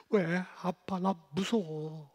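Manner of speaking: high, slow pleading; cling to father in fear; scared child. Retold or imitated. Daughter answers father frightened. (아빠에게 매달리는 겁먹은 딸)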